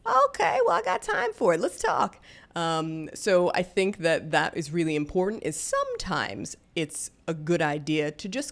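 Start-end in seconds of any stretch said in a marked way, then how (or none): 0.0-2.2 much higher, daring and amused; mock dare, louder; playful challenge to a rude stranger; sassy challenge. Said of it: "I'm just like".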